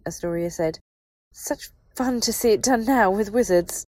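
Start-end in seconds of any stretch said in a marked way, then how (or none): 1.3-3.8 high, loud, brittle cheer; raised, manically bright sneer; bitter mock delight. A narrator's reading. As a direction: gush it with manic sarcasm. Bitter mock delight from hysterical wife.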